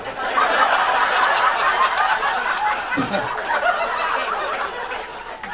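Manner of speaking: breathless high-pitched wheeze laugh; wheezing high laugh. Real, not acted. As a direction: laugh till the voice cracks up high. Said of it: "Which would be weird".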